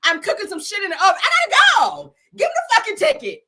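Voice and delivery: loud, high and indignant to the cop; hammed-up entitled driver in a hurry; entitled back-talk.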